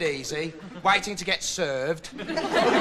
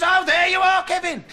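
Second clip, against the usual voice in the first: high, loud exclamation; high-pitched confrontational greeting; producer's testy greeting; 11 semitones higher, 4 dB louder than usual. Acted. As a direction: call it out high, as if catching a shirker. Producer confronts Kevin at door.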